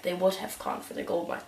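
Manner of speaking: usual speaking voice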